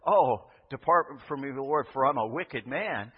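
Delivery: tearful plea. Retold or imitated. Thomas weeping to Jesus, loud remorseful plea. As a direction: cry out like a man in tears. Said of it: "with all the emotion that comes from".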